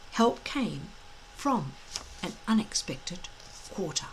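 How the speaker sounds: ordinary delivery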